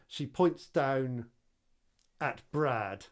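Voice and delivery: ordinary delivery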